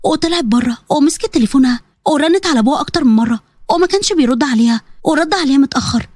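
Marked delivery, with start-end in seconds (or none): none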